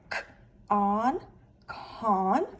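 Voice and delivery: "k" as a hard k sound, then "on", then blended into "con". Shown as a demonstration. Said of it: "it makes the hard C sound".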